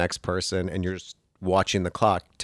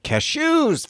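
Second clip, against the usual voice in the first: 1 sudden realization. Retold or imitated. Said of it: "you're like".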